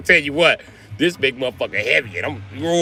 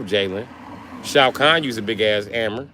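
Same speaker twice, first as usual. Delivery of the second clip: ordinary talk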